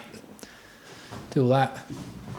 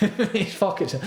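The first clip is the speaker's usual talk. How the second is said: laughing; 7 semitones higher than usual. Real, not acted. Laughs while repeating.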